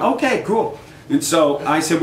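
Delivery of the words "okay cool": high startled okay cool; taken aback but pleased; surprised high okay; caught off guard. Retold or imitated. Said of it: "And I went".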